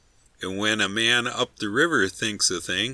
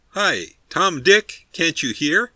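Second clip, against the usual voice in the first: louder, higher and slow, losing patience; impatient shout; 7 semitones higher, 7 dB louder, slower than usual. A narrator's reading. Man in the boat yelling at the towers ahead, impatient shout. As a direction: call out sharply across the water. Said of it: "Not quite so affably this time".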